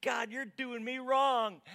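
loud, high-pitched rant at God; outraged protest, hammed up; exasperated prayer. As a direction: shout your complaint up to heaven. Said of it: "Have you ever had this prayer?".

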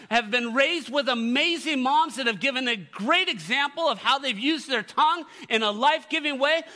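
loud, high, emphatic preacher voice; preaching. Real, not acted. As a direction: preach it with full force to the congregation. Preaches at full force.

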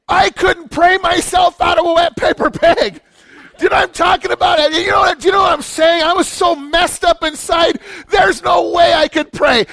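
impassioned sermon shout; full-force preaching. Real, not acted. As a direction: preach it out at the top of the voice.

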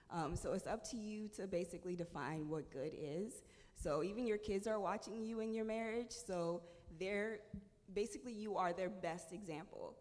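ordinary delivery

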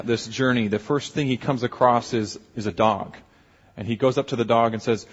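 usual speaking voice

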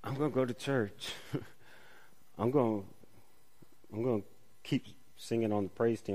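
soft, low grumble muttered half to yourself; a soft mock grumble at God, going through the motions; quiet grumbling. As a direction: grumble it quietly under your breath. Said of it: "this grumbling looks at our lives and says".

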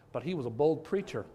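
ordinary talk, nothing marked

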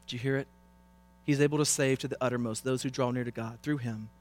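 ordinary talk, nothing marked

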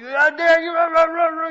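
loud, high, mock furious shout; hammed-up angry outburst; angry brother yelling. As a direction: yell it like a brother defending you in a fight. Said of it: "and he's like".

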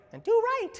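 high squeaky cartoon cricket; Jiminy Cricket voice. Shown as a demonstration. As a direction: chirp it up high like a tiny conscience. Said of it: "That's my Jiminy Cricket voice".

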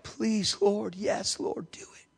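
soft prayer fading into a whisper; hushed pleading. Retold or imitated. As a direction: imitate elders quietly pleading with God. Trembling elders' quiet prayer, imitated.

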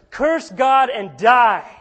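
loud, slow, cutting command; harsh command. Retold or imitated. Wife shouting at suffering Job, slow and loud. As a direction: hurl it at him like the wife.

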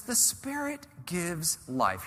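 slow, weighty, each word deliberate; slow emphasis. Shown as a demonstration. Preacher stresses the key verse, slow and weighty. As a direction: lay down the principle slowly. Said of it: "Here's the principle".

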